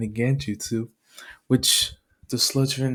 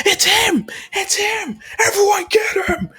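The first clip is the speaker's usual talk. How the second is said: high, urgent yell; rally everyone to seize a villain; shouted alarm; 3 dB louder than usual. Acted. Heroes raise the alarm.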